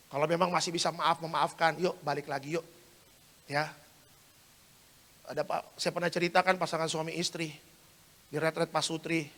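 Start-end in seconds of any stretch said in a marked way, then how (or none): none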